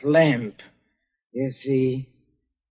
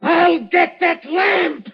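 crazed shouting threat; 14 semitones higher than usual. Acted. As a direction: scream it like a madman dragging them down. Dying man threatens to take everyone.

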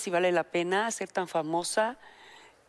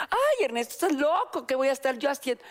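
incredulous; 5 semitones higher than usual. Retold or imitated. Responde a Ernesto, incrédula.

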